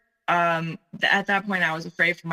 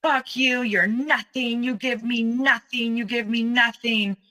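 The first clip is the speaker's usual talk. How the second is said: retold screaming; 5 semitones higher than usual. Ex-fiancé screaming that she gives him nothing, mock yell. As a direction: imitate his rage, pitched up. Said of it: "screaming like I've never heard a human being scream".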